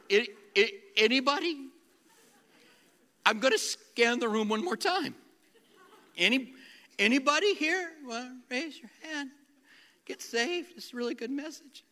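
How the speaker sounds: high, hopeful preacher coaxing an empty room; awkward pastor begging for a response; pleading altar call. Retold or imitated.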